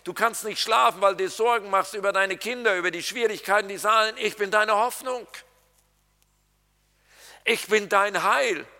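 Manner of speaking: lightly voiced God saying hey, I am your hope; voicing God. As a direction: speak as God reassuring a sleepless listener. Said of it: "dass Gott sagt".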